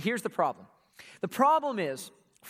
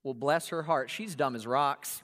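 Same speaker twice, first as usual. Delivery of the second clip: lower, softer mock sweetness over an insult; sugary Southern put-down; 7 semitones lower, 3 dB quieter than usual. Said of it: "look at your friend and say".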